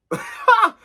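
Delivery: short, high-pitched burst of laughter. Real, not acted. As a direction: let out a sudden high cackle. Laughs at his own confession.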